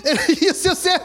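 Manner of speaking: ordinary talk, nothing marked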